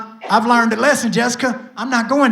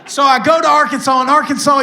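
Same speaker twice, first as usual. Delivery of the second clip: preaching at full force; 6 semitones higher, 4 dB louder than usual. Real, not acted. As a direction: preach it to the room. Preaching about God's power.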